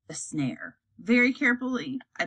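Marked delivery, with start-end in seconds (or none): 0.1-0.8 hushed whisper, lower and softer. A narrator's reading. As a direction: breathe it out as a discovery. Spotting the trap in the dark.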